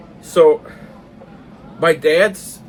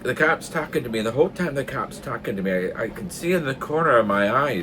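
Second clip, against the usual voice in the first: usual speaking voice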